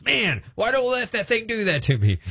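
high, wondering voice; amazed. Retold or imitated. Amazed man.

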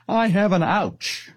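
put-on mild high child voice, slowed; lightly imitating a hurt small boy; little grandson. Grandfather voicing his crying grandson's small voice.

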